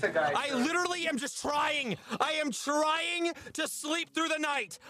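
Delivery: high whiny hammed-up rant; mock frustration. Streamer ranting high-pitched at his game, mock despair. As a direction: whine in high comic despair.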